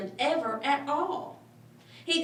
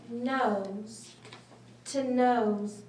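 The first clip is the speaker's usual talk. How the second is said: usual speaking voice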